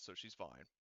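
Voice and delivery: usual speaking voice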